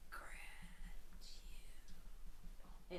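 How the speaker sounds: rasping ghostly whisper, breathy and low; guttural whisper. Retold or imitated. Owner replays the ghost's recorded voice in a guttural whisper. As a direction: breathe it like a voice from the dark. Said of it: "in this guttural whisper".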